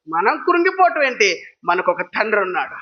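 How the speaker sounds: usual speaking voice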